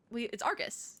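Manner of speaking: ordinary delivery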